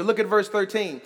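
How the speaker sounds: ordinary talk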